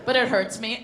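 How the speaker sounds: ordinary talk, nothing marked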